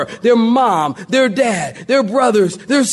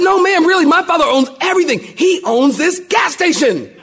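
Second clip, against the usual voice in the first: boastful; 7 semitones higher than usual. Acted. Preacher boasting to salesman.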